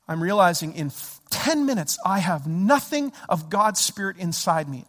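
usual speaking voice

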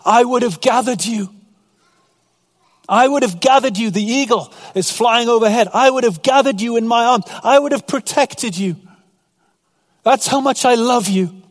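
a hint of a grieving Jesus calling his people; pleading Jesus. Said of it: "And he says".

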